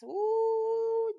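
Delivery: high, loud gasp of shock; sudden shocked ooh at the discovery. Retold or imitated. Shock at finding the undergarments.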